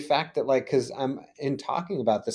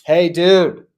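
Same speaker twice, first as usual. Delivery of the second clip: loud, raised, emphatic mock yell; mock screaming; 4 semitones higher, 5 dB louder than usual. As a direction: call out like an urgent warning from the universe. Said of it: "screaming at me".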